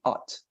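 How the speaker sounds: "at" as open O sounding ah, closed by T; American ought. Shown as a demonstration. Teacher breaking the word into O and T, American ought. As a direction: say "at" like "aht". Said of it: "the O and the T".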